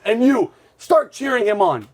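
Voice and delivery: retold drill-instructor command. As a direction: imitate the instructor ordering a bystander to join in. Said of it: "he goes to my friend Jamal".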